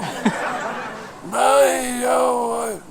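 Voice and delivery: a shouted burst of laughter, high; loud laugh. Real, not acted.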